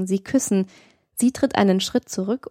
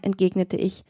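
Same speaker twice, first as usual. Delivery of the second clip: ordinary delivery, nothing marked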